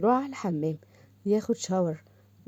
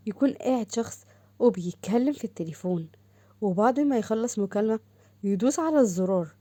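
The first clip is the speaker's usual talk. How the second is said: ordinary delivery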